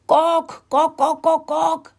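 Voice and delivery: high, loud clucking alarm; high-pitched shrieking chicken clucks; squawking hen. A narrator's reading. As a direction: squawk like a panicked hen kicking up a racket. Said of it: "schrie Steffi und machte einen fürchterlichen Lärm".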